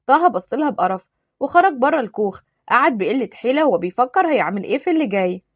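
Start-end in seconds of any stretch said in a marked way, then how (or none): none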